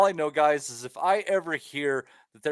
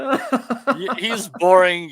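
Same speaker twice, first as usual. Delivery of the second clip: laughing as he repeats it; much louder, laughing it out; laughing, louder insult; 4 semitones higher, 7 dB louder, much slower than usual. Real, not acted. Teasing the co-hosts, louder.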